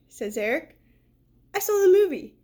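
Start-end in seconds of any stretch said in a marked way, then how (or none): 1.5-2.4 put-on eager kid. Eric excited about movie.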